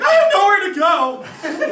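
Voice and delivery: put-on loud, high, drawn-out kid's wail; hammed-up childhood bawling; mock bawling. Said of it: "bawling for half an hour".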